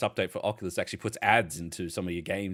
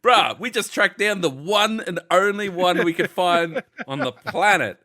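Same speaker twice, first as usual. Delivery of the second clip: loud, high, slow mock outrage; hammed-up exasperated shout; exasperated retort; 9 semitones higher, 10 dB louder, slower than usual. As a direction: throw it back at the customer, fed up.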